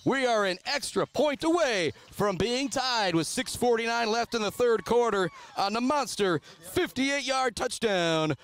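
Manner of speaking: high, excited sportscaster voice, easing gradually; thrilled touchdown recap. Real, not acted. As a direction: still buzzing from the big play. Recapping the touchdown just scored.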